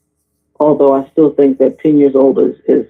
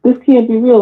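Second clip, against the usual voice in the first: disbelief; 6 semitones higher than usual. Retold or imitated. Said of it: "I was like".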